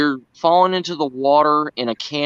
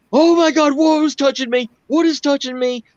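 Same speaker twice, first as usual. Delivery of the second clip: imitating panicked Brad; 13 semitones higher than usual. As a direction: squeal it out like something grabbed you in the water.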